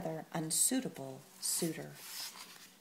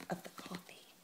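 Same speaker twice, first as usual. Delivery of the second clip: usual speaking voice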